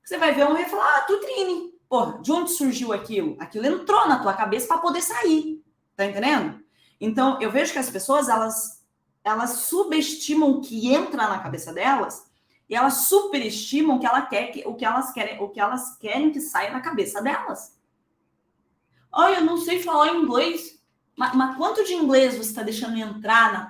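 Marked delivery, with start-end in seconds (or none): 1.0-1.7 amused recognition. Pretend. Sudden recognition of word. 19.1-20.8 whiny mock complaint. Mocking complaining student.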